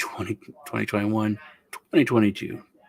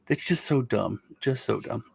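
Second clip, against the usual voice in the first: usual speaking voice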